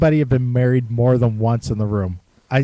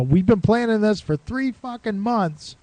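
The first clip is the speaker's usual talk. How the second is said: retold exasperated rant, pitched higher; higher, fed-up host; 8 semitones higher than usual. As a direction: play the frantic host whose plan is falling apart. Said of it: "He's like".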